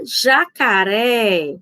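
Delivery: "jacaré" slowly, holding the final "ré"; stress on RÉ. Shown as a demonstration. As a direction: draw out the last syllable "ré" of "jacaré". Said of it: "a mais forte".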